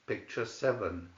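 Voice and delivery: ordinary delivery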